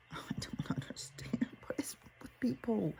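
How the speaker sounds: breaking into a high, whispery laugh of disbelief; helpless, squeaky and half-voiceless; exasperated bafflement. Real, not acted. Mother baffled by people's travel choices, exasperated.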